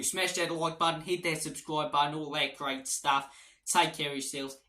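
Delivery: hammed-up Aussie sign-off; Australian accent. Spurs fan urging viewers to subscribe, mock Australian accent. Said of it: "Trying to be Ange Postecoglou".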